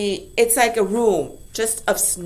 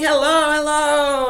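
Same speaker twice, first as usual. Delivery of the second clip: slow, drawn-out calling hellos; teacher calling hello, mildly acted; loud hellos; 5 semitones higher, 4 dB louder than usual. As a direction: call hello across the snowy field. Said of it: "It's nice and loud".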